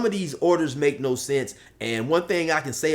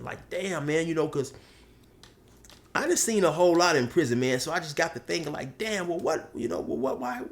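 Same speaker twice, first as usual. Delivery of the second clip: ordinary talk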